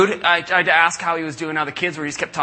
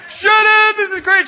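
much higher, louder, overjoyed Greek-style shout; thrilled shout; 7 dB louder than usual. Retold or imitated. Mike shouting with joy at reaching Shannon. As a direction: burst out with big-fat-Greek-wedding joy.

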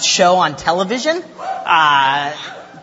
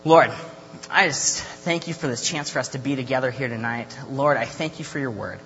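softer, lower prayer voice, fading quieter; spoken prayer; 6 semitones lower, 8 dB quieter than usual. Real, not acted. Youth leader praying for the group before teaching, hushed prayer. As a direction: pray quietly, sinking lower toward the amen.

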